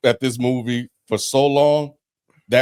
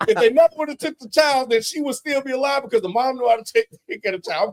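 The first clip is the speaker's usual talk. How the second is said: high, worked-up rant; going the fuck off at the TV, pitched up; 11 semitones higher than usual. Retold or imitated. Husband going off at the TV about CPS, high and heated. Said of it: "I'm going off".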